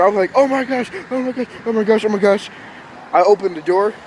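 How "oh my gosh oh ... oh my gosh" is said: high, rapid-fire repeated panic; panicked. Retold or imitated. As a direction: re-enact a kid spiralling, pitched way up. Panicking as firefighters knock.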